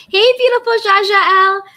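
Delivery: high, loud hello; excited greeting. Real, not acted. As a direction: greet the fan brightly.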